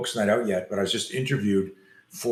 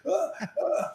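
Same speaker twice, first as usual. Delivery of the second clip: very high faked whimpering cries; feigned pain cries; 3 dB quieter than usual. Boy on the ground fakes pain for the fleeing bullies, very high cries. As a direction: put on pained cries from the ground. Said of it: "I feigned this fear, and I feigned this pain".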